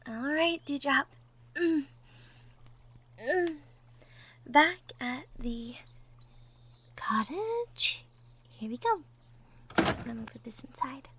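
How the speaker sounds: gentle, hushed ASMR character voice, often whispering; soft-spoken roleplay girlfriend. Acted. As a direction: talk softly to the nervous listener.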